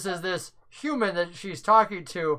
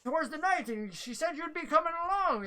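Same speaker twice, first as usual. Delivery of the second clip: higher, softer NPC voice; lightly voiced, quietly passing on a message; 9 semitones higher, 5 dB quieter than usual. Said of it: "and he goes".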